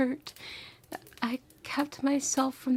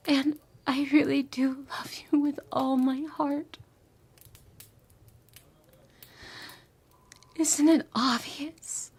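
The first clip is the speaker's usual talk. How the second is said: tender whisper. Acted. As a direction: whisper it like a lover's confession.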